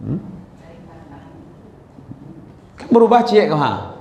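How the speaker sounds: ordinary delivery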